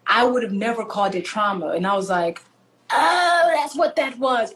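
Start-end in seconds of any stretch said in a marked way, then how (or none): 2.9-4.5 drawn-out "oh" of realisation, higher at first; dawning realisation. Retold or imitated. Woman recalls what therapy revealed to her, dawning realisation. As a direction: let the penny drop slowly.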